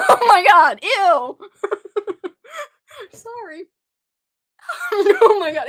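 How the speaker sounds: high giggling through the words; laughing fit. Real, not acted. Tongue turns green, laughing.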